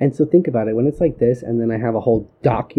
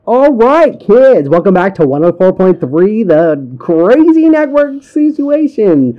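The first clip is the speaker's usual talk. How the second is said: loud, high radio DJ intro; radio host voice; 8 semitones higher, 10 dB louder, slower than usual. Pretend. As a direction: announce it like a cheesy DJ.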